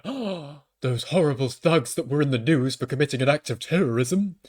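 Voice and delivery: mock horrified gasp into scandalised complaint; outraged tabloid reader. Mocking outraged tabloid readers over forty.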